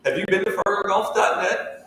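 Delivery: over-the-top promo voice, tongue in cheek; cheesy ad plug. Acted. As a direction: sell the website like a corny radio ad. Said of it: "our really bad plug".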